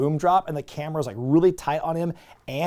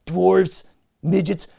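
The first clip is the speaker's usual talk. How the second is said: Triple H voice; 4 semitones higher, 3 dB louder than usual. Retold or imitated. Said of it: "Triple H goes".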